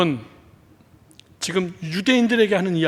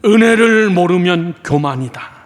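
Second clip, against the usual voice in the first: loud, slow, emphatic recitation; louder repeat; 3 semitones higher, 8 dB louder, slower than usual. Shown as a demonstration. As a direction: lead the crowd in it loudly, drawn out. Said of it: "한 번만 더 크게 시작".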